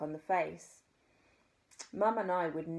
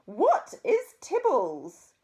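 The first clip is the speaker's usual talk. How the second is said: loud, slow, high horrified question; aghast posh lady; shocked; 7 semitones higher, 10 dB louder, much slower than usual. A narrator's reading.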